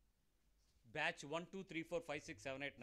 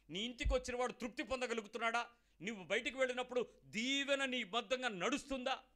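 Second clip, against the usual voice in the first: high, driving string of challenging questions; impassioned preaching; 5 semitones higher, 4 dB louder than usual. Acted. Preacher questioning the believers, rising impassioned preaching. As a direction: press each question home.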